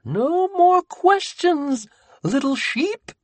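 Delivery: very high, very slow creepy coo; a playful menace to a trapped lamb; giggly Sammy. Acted. Sammy cooing to Buddy to stop his questions. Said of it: "he giggled".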